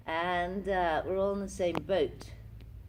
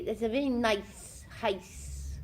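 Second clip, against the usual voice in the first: slow, lightly posh Sloane Ranger imitation; 5 semitones higher, slower than usual. [name] copying the Sloane Rangers on the bus, lightly mimicked. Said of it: "they used to say".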